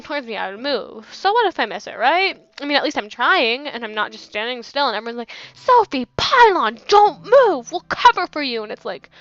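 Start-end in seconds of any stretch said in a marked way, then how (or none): 5.5-8.6 imitated teammates yelling, much higher and louder; loud mocking command; mock shouted orders.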